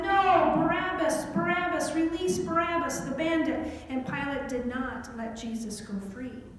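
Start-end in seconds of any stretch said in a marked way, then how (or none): 0.0-3.7 loud, high chanting demand; crowd shouting. Acted. The crowd yells to Pilate, demanding Barabbas in a loud chant. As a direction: shout it like a mob. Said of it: "But the crowd said".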